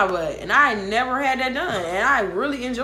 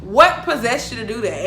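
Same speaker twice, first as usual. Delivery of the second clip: raised voice, insistent questioning; retold outraged demand; 3 semitones higher, 6 dB louder than usual. Storyteller replaying her questions to the man, loud outrage. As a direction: fire off loud, incredulous questions.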